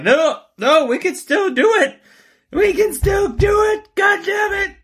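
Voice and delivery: high, shouting mock senator; hammed-up senator yelling to vote anyway. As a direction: squeal it like a desperate senator insisting. Mocking senators insisting on voting.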